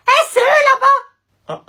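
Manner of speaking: very high, slow, crying shout of fear; boy spots the older brother coming; panicked shriek. Acted. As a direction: scream in terror at the bullies. Boy sees the bullies coming.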